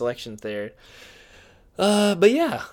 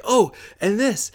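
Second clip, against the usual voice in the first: higher, louder burst of eagerness; acted sudden inspiration; 9 semitones higher, 6 dB louder, slower than usual. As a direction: jump in as if an idea just sparked. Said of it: "spark some kind of thing in my head".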